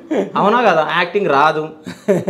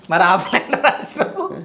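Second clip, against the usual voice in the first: slow words through laughter; laughing; 3 semitones higher, slower than usual. Real, not acted. Host repeats the guest's line while laughing.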